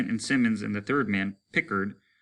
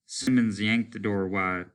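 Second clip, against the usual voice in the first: ordinary delivery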